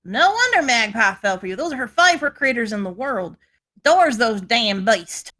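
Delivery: Southern twang in place of a Scottish one; Southern accent. Acted. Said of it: "slipped into the, the southern accent".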